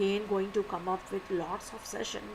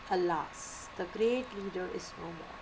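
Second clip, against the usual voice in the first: softer, subdued sigh of grief; grieving, softer; 3 semitones lower, 6 dB quieter than usual. Shown as a demonstration. Teacher showing students grief, softer and mellowed down. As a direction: mellow down as if mourning a loss. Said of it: "showing some side of grief".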